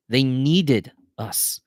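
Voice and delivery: "needed" stressed high and drawn out; very slow, loud weight on "needed"; emphatic insistence. A narrator's reading. Earnest repeat for emphasis.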